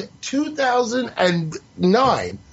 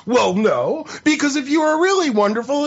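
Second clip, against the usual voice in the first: ordinary delivery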